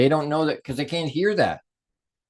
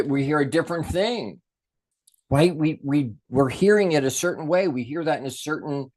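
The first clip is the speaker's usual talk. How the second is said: ordinary talk, nothing marked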